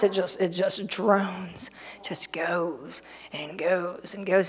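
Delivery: slow, low and trailing away softly; dragging drone. Acted. Preacher showing how a forty-day fast drags, slow fading drone. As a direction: drone it out wearily, stretching each word.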